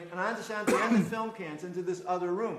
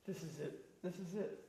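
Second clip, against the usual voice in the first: hushed dread; 12 dB quieter than usual. Retold or imitated. The speaker in the kirtan, sensing the raid, hushed dread. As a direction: breathe it to yourself, sure the end has come. Dreading the police about to enter.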